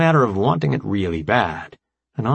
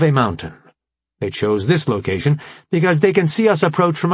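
formal AI commander, raised and emphatic; brief the allies in a higher, deliberate voice; Alpha Prime; 5 semitones higher than usual. Acted.

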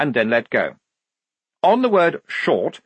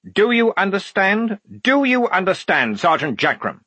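high, insistent officer asserting authority; exasperated dressing-down; 7 semitones higher, 3 dB louder than usual. Acted. Lieutenant overruling his sergeant, exasperated and commanding. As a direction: press each question harder on the sergeant.

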